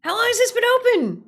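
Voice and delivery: high loud shocked cry; shocked outburst. Real, not acted. Streamer suddenly shocked, loud high cry. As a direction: yelp it in alarm.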